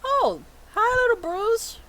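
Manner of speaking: very high voice for greeting a little one; high cutesy greeting. Acted. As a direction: coo hello as if to someone tiny. Teacher greets a small creature.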